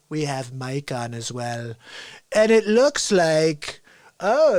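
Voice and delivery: put-on politician musing aloud; Donald Trump. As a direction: savour each phrase, then let it sink. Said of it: "I think Donald's gonna be on".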